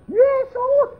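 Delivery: very high, drawn-out mock scream; hysterical screaming. Wife calling on Jesus for her husband, acted screaming. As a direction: cry the name out as if hysterical. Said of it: "teriak-teriak".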